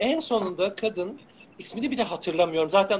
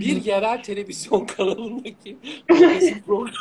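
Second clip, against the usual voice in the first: laughing through words; high burst of laughter; 4 semitones higher, 6 dB louder than usual. Real, not acted. Laughs naming local show.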